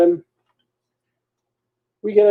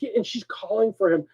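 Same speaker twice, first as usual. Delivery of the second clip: ordinary delivery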